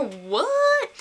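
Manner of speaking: high, exclaimed surprise; astonished. Real, not acted. Fan reacting to a surprise proposal, high astonishment. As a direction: react in amazement, voice leaping up.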